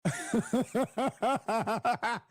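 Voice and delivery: bursting out in a high loud laugh; big real laugh at the clip. Real, not acted.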